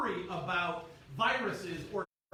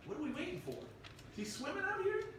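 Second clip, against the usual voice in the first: puzzled disciples; 7 semitones higher, 6 dB quieter than usual. Retold or imitated.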